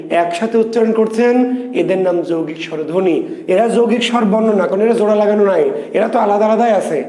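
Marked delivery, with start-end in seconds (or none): none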